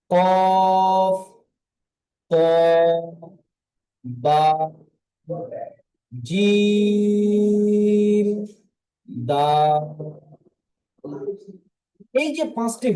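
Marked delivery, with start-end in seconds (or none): 0.1-9.8 each Arabic letter name said slowly, one by one; drawn-out letter names. Shown as a demonstration. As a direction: spell out the letters with long pauses. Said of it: "কুতুবু জাদ্দিন কাকে বলে?".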